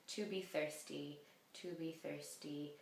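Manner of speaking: ordinary talk, nothing marked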